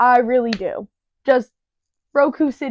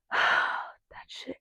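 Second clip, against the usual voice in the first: whispered aside; 11 dB quieter than usual. Real, not acted. Muttered aside after sigh.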